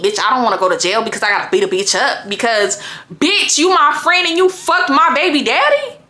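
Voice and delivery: normal talking voice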